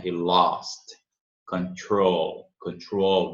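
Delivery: control with a dark L at the back of the throat. Shown as a demonstration. Teacher demonstrating the dark L in control. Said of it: "dark L".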